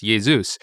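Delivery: "Jesus" as YAY-zoos; Greek pronunciation. Shown as a demonstration. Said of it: "is generally how it's pronounced".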